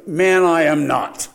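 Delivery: loud, slow, forceful refusal; emphatic denial. Acted. Preacher voices Peter denying he is one of them, emphatic. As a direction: push back hard, weighting each word.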